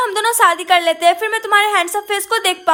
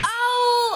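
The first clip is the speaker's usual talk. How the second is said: sudden cry of pain, softer; 2 semitones higher, 5 dB quieter than usual. Acted. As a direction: yelp as the eyes sting. Eyes hurt by punishment.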